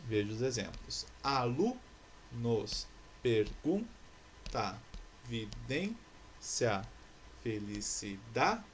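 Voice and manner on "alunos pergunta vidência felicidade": very slow "Alunos, pergunta, vidência, felicidade", each penultimate syllable stretched; stress on penultimate syllable. Shown as a demonstration. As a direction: hold LU, GUN, DÊN, DA in "Alunos, pergunta, vidência, felicidade", then pause. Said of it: "Todas essas palavras possuem sílaba tônica a penúltima". (Alunos, pergunta, vidência, felicidade bem devagar, alongando a penúltima sílaba)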